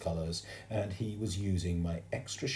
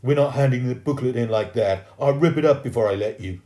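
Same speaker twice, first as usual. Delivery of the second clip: loud, higher threat; angry shouting; 5 semitones higher, 11 dB louder than usual. A narrator's reading. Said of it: "Jimmy shouted".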